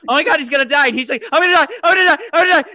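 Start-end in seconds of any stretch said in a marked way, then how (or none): 0.1-0.9 alarmed gasp. Retold or imitated. Audience reaction to the scene. 1.3-2.7 panicked cry. Acted. Film character panicking in bed.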